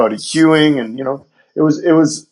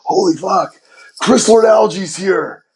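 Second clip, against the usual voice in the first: breathless excitement; 6 semitones higher, slower than usual. Retold or imitated. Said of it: "my assistant comes running".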